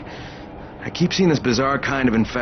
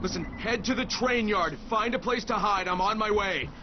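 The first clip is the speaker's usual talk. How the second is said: higher, tense and quick commands; urgent orders; 7 semitones higher, 8 dB quieter than usual. Acted. Crane orders Rahim to hide, urgent. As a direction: get him to safety now.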